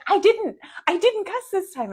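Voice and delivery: high, loud, protesting; indignant protest. Retold or imitated. To the coach after the ump's call, high loud protest. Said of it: "And I was like".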